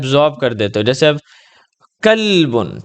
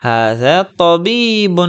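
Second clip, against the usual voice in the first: slow, clear Arabic for the pupil to repeat; slow model reading; 3 semitones higher, much slower than usual. Shown as a demonstration. Teacher reads ھذا طبیب slowly for pupil to copy, slow model reading. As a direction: read it slowly, word by word. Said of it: "من ھذا؟".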